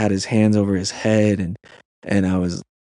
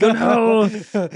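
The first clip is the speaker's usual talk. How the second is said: much higher, hammed-up "Oh, no."; mock dread; 11 semitones higher than usual. Counselor realizing the troubled kid is his camper, hammed-up high oh no. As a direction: play up the sinking feeling with a laugh. Hears the labeled kid joins cabin.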